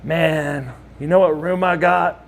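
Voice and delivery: ordinary delivery, nothing marked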